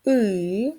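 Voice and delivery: И drawn out by itself; И as one sustained vowel sound; isolated sound. Shown as a demonstration. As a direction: hold the vowel И long. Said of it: "Другий звук".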